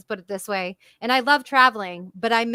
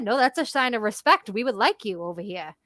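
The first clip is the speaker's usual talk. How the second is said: ordinary talk, nothing marked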